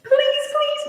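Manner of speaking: high pleading please, please; imitating a man begging, pitch climbing; mock begging. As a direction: beg like the stranger, voice rising. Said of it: "He started begging me".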